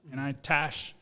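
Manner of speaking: normal talking voice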